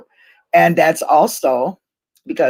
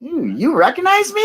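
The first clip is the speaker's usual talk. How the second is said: much higher, flattered surprise; surprised delight; 13 semitones higher than usual. Retold or imitated. Retelling being recognized at the rally, high and flattered. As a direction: gasp it out like being caught off guard by a fan. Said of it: "I went".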